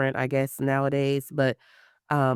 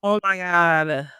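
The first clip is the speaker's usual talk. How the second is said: drawn-out, dismayed reaction; retold dismay; 3 semitones higher, 3 dB louder, slower than usual. Mom reacting to her son's request, drawn-out dismay. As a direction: stretch it out in mock shock.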